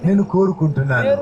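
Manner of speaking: earnest pleading prayer, phrase by phrase; fervent prayer. Acted. Pastor leading the congregation's prayer aloud, fervent pleading prayer. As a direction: pray it aloud for all to follow.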